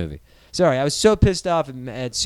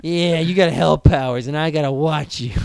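lightly voiced sneering clown; a hint of a taunting demon clown; mock clown taunting Spawn, lightly voiced; evil demon clown; 4 dB louder than usual. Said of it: "as the evil demon clown who's like".

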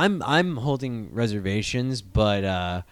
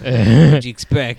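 mock Krampus; 9 dB louder than usual. Voicing Krampus bit.

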